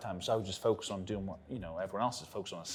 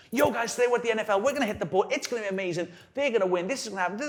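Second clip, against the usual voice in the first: higher, louder, faster YouTuber hype; hyped vlogger intro; 10 semitones higher, 5 dB louder, faster than usual. Retold or imitated. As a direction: sell the day to the camera. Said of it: "I've gone from being like".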